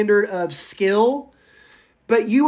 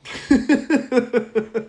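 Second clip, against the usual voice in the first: rapid staccato chuckle; amused run of chuckles; laugh; 3 semitones higher than usual. Real, not acted. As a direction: laugh in a quick string of short bursts. Laughs after own joke answer.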